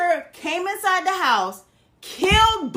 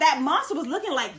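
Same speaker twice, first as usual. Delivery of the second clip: ordinary talk, nothing marked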